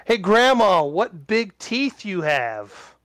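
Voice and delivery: high playful storybook call. Acted.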